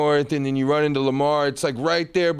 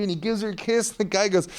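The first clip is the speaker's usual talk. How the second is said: ordinary talk, nothing marked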